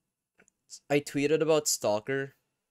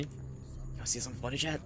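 teasing hushed; 4 semitones higher, 7 dB quieter, faster than usual. Real, not acted.

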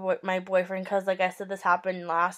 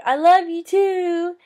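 slow, higher and louder joking shout; playful yell back; 8 semitones higher, 6 dB louder, slower than usual. Retold or imitated. Narrator yelling back at the drunk guy as a joke. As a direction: yell it back just to be funny. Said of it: "I yelled back".

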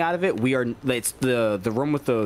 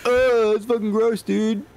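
slow, louder disgusted voice; grossed-out monster voice; 8 semitones higher, 3 dB louder, slower than usual. Pretend. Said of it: "be like".

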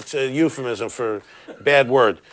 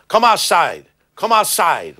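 put-on thick Cuban accent, shouted; angry Cuban accent; bad Cuban accent; 4 semitones higher, 4 dB louder than usual. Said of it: "I'm doing a bad, it's a bad accent".

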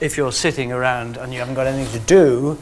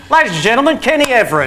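higher, grand announcer voice of the host; TV host intro; 8 semitones higher, 5 dB louder, faster than usual. Retold or imitated. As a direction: announce him like a showman. Host curtly introduces guest.

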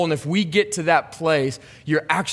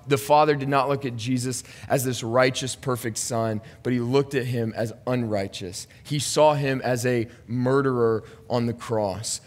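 ordinary delivery

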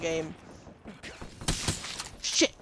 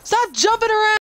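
loud, high yell at jumping players; angry shout; 13 semitones higher, 6 dB louder than usual. Real, not acted. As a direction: yell at them to stop. Yelling at jumping enemies.

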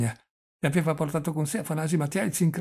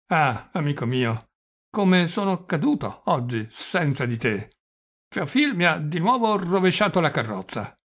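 put-on doddering old prince, plaintive; 4 semitones higher, 5 dB louder than usual. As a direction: lament a mishap as a feeble old prince. (vecchio principe lamentoso, parole trascinate)

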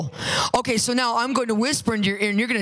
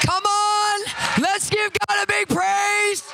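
shouting; 9 semitones higher, slower than usual. Real, not acted. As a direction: call the whole room to praise. Preacher calls crowd to praise.

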